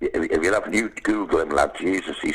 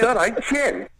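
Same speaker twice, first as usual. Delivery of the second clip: higher, sing-song call of "All right, Jim"; lads calling a greeting; slower than usual. Retold or imitated. Old school mates hailing Jim across the road, sing-song call. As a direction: call it out like a mate spotting him in the street.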